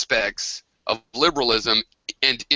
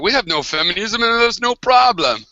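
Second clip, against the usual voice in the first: higher, louder mock quote of V, light Romanian accent; hammed-up impersonation of a dismissive man; 9 semitones higher, 4 dB louder than usual. Mocking V's claim about Romania, higher and louder.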